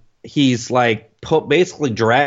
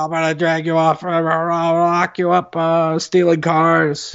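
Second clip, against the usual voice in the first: mock angry yelling; 3 semitones higher than usual. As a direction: act out a scolding father, stretching the words. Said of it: "yells at him".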